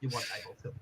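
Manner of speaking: very soft, creepy little tease; sly hushed offer. Retold or imitated. Son slyly offering dad the joke dish, hushed and teasing. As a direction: drop to a near-whisper, teasing.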